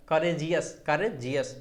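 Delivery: "Courageous" split as करे-जियस; two deliberate beats in "courageous"; pause inside word. Shown as a demonstration. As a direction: repeat "courageous" with a brief gap before Jesus. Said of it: "pause है".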